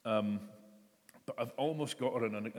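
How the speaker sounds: usual speaking voice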